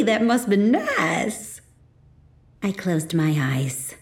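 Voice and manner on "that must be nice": slow, drawn-out envious gush. A narrator's reading. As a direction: linger on nice with envy.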